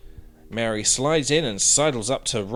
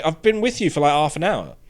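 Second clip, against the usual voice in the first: normal talking voice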